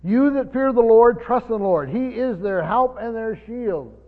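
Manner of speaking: loud, ringing scripture read, slowing at the end. Acted. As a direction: proclaim the verse to the congregation. Reads scripture aloud.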